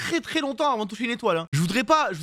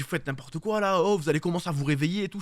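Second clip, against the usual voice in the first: ordinary talk, nothing marked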